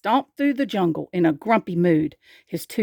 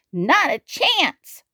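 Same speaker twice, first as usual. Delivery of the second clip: high, slow sulky refusal; drawn-out childish sulk; 7 semitones higher, much slower than usual. A narrator's reading. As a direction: refuse like a sulky little dinosaur. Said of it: "sulked Tiny".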